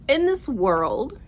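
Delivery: slow whispered movie-trailer opener; mock trailer whisper. Reviewer starting the plot summary in a slow trailer whisper. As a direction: hush it like a trailer voice.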